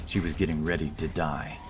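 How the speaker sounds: ordinary delivery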